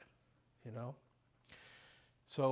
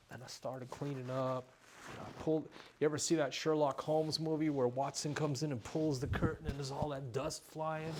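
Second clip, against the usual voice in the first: ordinary talk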